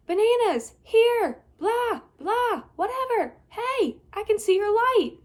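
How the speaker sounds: high, loud playful shouting; silly yells. Acted. Trapped girl yelling so boy can hear, high loud. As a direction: holler anything at all.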